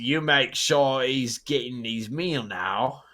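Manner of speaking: put-on menacing British creature accent, taking his time; a lightly voiced British monster. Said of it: "was a British voice".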